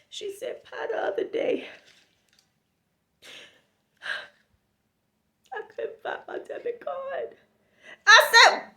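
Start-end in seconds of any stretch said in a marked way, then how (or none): 0.6-7.4 soft, higher, tearful whine; hammed-up sob story; mock crying. Friend confessing the lost card, mock crying.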